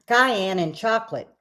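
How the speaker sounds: talking while choking; choked-up words mid-swallow. Real, not acted. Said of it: "I'm about to choke".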